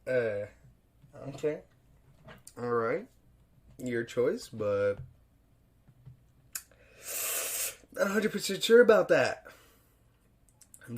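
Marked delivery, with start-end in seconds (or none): 0.1-9.5 hesitate, then blurt your doubt; sceptical. Retold or imitated. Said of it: "And I'm like".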